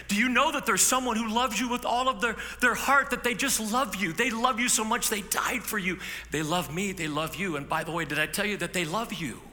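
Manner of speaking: high, loud, pleading voice full of love; earnest plea. Acted. Preacher voicing an evangelist to a stranger, high and loud. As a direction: plead it like telling someone they're loved.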